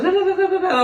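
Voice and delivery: high-pitched "da-da-da" chatter; high nonsense syllables standing in for speech; imitated frantic babble. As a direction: mimic someone jabbering in a high voice. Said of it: "and the text goes".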